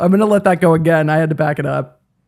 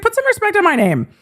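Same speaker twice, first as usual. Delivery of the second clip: mocking squeaky high imitation, much higher; 13 semitones higher than usual. Vlogger mimics defendant demanding respect, much higher. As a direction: pitch way up to mock him. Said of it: "he's like".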